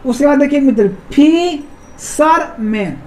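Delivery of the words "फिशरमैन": फिशरमैन slowly, pausing between फि, शर, मैन; three long separated beats on फिशरमैन; split into syllables. Shown as a demonstration. Teacher showing students three syllables, split into syllables. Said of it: "तीन syllable".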